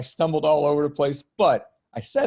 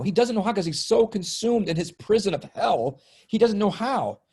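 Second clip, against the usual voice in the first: higher and a bit louder, fervent sermon build; impassioned preaching; 8 semitones higher, 3 dB louder than usual. Real, not acted. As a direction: drive the message home to everyone. Impassioned preaching about healing.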